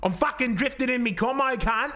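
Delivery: lightly put-on Lebanese Australian accent, aggressive. Showing a mate a Lebanese Australian accent, aggressive. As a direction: throw a mild Western Sydney Lebanese Australian accent at him. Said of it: "a Lebanese Australian accent".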